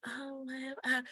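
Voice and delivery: barely audible mumble; mumbling demo. Shown as a demonstration. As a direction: mumble it under your breath. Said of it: "You don't wanna be mumbling".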